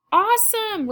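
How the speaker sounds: put-on jubilant, high-pitched kid's exclamation; excited cry. A narrator's reading. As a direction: cry out like a thrilled girl. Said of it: "Rosa cries".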